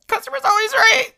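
very high, loud mock customer-service squeal. Employee parroting the rule she could not fight, mocking squeal. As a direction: shriek it out in a squeaky put-on voice.

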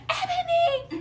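very high-pitched name call; shrieky mock call from afar; sister calling out. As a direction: imitate a sister's squealed call. Sister calling her name, retold.

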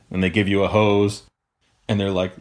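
ordinary talk

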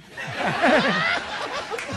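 sudden high burst of laughter; laughing at Rucho's sneakiness. Real, not acted. (risa aguda y repentina)

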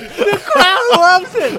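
loud, high, slow words stretched through laughter; laughing shout. Real, not acted. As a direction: howl it out while laughing hard. Fell for Joe Mama joke.

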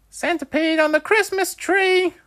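very high, loud gleeful shout; excited punchline. A narrator's reading. Wolfie delivering his joke's punchline, very high and loud. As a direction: land the punchline with delight. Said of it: "Wolfie exclaimed".

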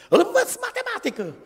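high shrill mock shout; imitating a defensive mother yelling; mother yelling back. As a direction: snap back like the irritated mom. Mother snaps back at old man.

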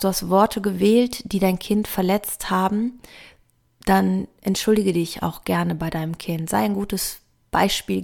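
normal talking voice

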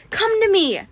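high-pitched desperate plea. A narrator's reading. Captive pleading for Grover to come, desperate. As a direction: beg him desperately, pitch leaping up. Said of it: "I thought desperately".

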